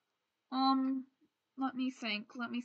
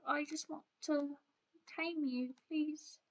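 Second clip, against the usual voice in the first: much softer, coaxing plea; soft pleading; 3 semitones higher, 11 dB quieter than usual. Real, not acted. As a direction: coax a shy animal very softly. Coaxing a wolf to come back.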